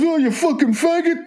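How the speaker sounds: high, jeering schoolboy taunt; mocking schoolboy. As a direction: sneer it like a teenage boy at a bus stop. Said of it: "he just gets up and goes".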